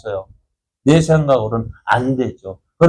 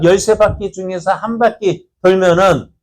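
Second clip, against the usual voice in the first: ordinary talk, nothing marked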